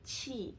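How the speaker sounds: qi with flat tongue and flat lips, aspirated; Mandarin qi. Shown as a demonstration. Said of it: "my tongue is completely flat".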